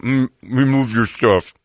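slow, muffled words round food; mouth full. Retold or imitated. Caught eating nachos, answers the lady with mouth full. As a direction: imitate talking through a mouthful of chips. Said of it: "I've got a mouthful of nacho chips".

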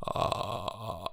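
creaky fried uh; vocal fry. Shown as a demonstration. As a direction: drop into a slow creak. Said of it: "It's this".

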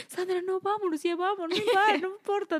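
imitating her scared little sister begging to leave. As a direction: hurry her away, worried. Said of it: "mi hermanita me decía".

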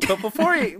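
normal talking voice

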